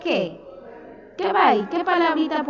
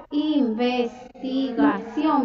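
Investigación drawn out even more slowly, each syllable apart; slower dictation; much slower than usual. Shown as a demonstration. Teacher repeating the word slowly for a pupil. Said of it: "con V y tilde en la O".

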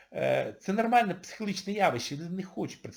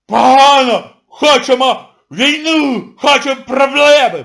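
loud, higher mock yelling of demands; mock shrieking crowd; 8 semitones higher, 14 dB louder than usual. Mocking the public who shrieked for trouble, loud mock yelling. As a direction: scream like a crowd being slaughtered. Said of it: "Ви ж верещали, як недорізані".

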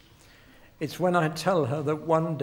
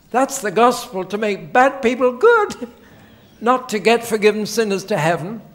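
raised, higher, louder proclamation; forceful preaching; 5 semitones higher, 8 dB louder than usual. Real, not acted. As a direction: drive the point home to the crowd. Preacher's climactic point to audience.

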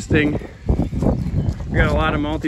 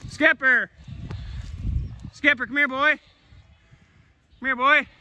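calling the dog; 11 semitones higher than usual. Real, not acted. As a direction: call Skipper across the field.

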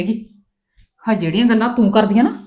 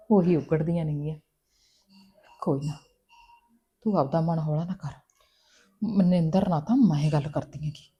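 softer, lower comforting words; gentle consoling; 6 semitones lower, 10 dB quieter than usual. Acted. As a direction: soothe her hurt gently. Sister-in-law consoles upset woman. (ਹੌਲੀ ਪਿਆਰ ਨਾਲ ਦਿਲਾਸਾ)

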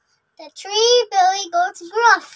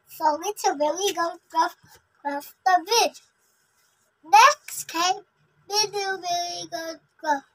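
usual speaking voice

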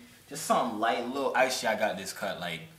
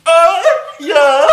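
excited; 14 semitones higher, 19 dB louder than usual. Real, not acted. Loud high exclamation.

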